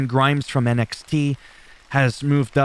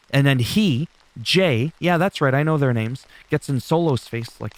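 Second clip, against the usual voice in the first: usual speaking voice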